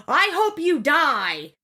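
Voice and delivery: high, drawn-out mock yell; imitated yelling. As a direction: stretch it out high like a sister yelling. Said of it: "the younger sister yells out".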